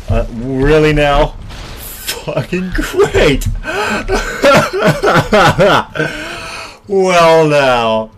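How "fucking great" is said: slow, sarcastic words melting into laughter; laughing. Real, not acted. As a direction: crack up at the disaster. Laughs at sudden game disaster.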